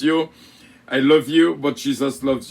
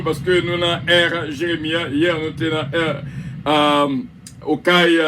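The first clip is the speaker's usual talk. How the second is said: ordinary delivery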